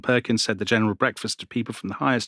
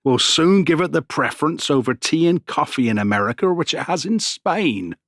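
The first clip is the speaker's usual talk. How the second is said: put-on reading Jefferson in an old American accent; colonial American accent; 4 semitones higher, 3 dB louder than usual. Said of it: "in his American colonial voice".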